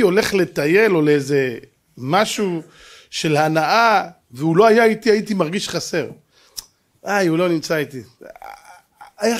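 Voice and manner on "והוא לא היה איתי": ordinary talk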